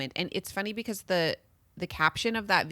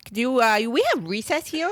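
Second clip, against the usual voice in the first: high, loud put-on kid voice; hammed-up kid asking at a job interview; childlike adult applicant; 6 semitones higher, 8 dB louder than usual. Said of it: "why they would talk like that".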